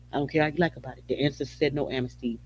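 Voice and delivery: ordinary delivery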